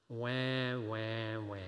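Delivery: mock crybaby wail, low and drawn out; whiny baby wah. Wife teasing her complaining husband, mock crying wah. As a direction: tease like a wife mocking a whiner. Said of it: "she goes".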